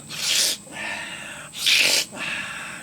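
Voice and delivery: two long, noisy snorts; exaggerated sniff and exhale; imitated snorting. Friend snorts cocaine from the bag off his finger, imitated snorting.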